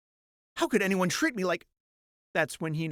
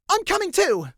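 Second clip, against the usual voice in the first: high, loud eager shout; 13 semitones higher, 5 dB louder than usual. Acted. Selene shouting after Mirthafan, high loud call. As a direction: call out to join. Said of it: "Selene shouts out".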